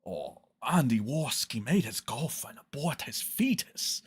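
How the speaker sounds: put-on British voice, mockingly; dumb British accent. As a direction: imitate him in a silly little British accent. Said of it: "In his dumb little British accent".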